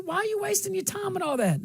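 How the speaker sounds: ordinary talk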